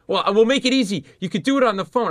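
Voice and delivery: high, rushed-sounding eager pitch; frantic over-eager pleading. Retold or imitated. Podcaster begging a music legend for an interview, frantic high pitch. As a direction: sell it desperately, voice up high. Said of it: "I was out of my mind".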